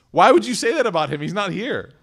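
loud, fast, high-pitched objection; indignant protest. Acted. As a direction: demand it in outraged disbelief. Aisha pushes back on her aunt.

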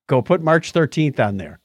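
ordinary talk

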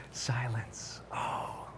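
playful whisper to hush the room. Pretend. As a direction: breathe it like listening to silence. Mock hushing the room.

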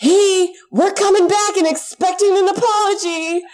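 high, loud, giddy mock read; gleefully reading out her own text; mock excited text. Telling the listener how she texted her mom, high, loud and giddy.

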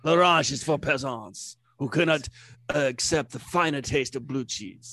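haughty French waiter; hammed-up French accent. Snooty waiter calling diner a peasant, French accent.

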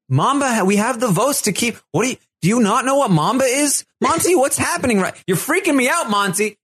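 very high-pitched frantic questioning; mock exasperation. [name] confronts her forgetful ally, exasperated. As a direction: imitate someone getting freaked out by a clueless partner.

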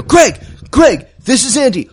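high, loud call into a radio; urgent radio call. Acted. Andy hails Greg over the radio, urgent call.

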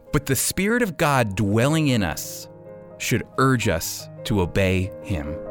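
ordinary delivery

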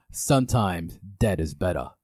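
low, slightly put-on movie old-timer; attempted Herman Munster. Host giving Leo a movie tagline clue, lightly voiced. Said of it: "I should have done the voice".

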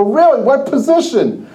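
high-pitched retold surprise; wary surprise. Linebacker hearing of a new rival, surprised. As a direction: act out a startled question.

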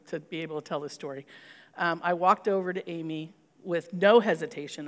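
ordinary talk, nothing marked

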